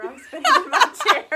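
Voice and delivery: loud high giggling; laughing. Real, not acted. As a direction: burst out laughing mid-sentence. Laughs while spinning in chair.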